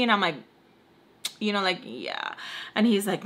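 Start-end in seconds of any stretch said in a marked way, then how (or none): 1.8-2.3 very low, soft "yeah"; mumbled awkward agreement, dropped deep; reluctant agreement. Retold or imitated. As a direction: sink the voice right down, noncommittal. Her reluctant retold agreement.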